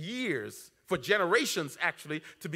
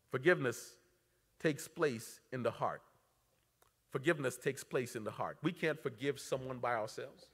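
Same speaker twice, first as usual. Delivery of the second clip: softly earnest; 5 semitones lower, 8 dB quieter than usual. Real, not acted. Quiet earnest sermon point.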